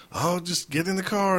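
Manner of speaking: casual reply. Retold or imitated. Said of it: "He's like".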